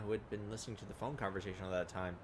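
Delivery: usual speaking voice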